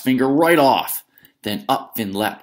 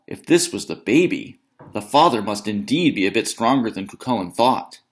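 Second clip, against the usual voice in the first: usual speaking voice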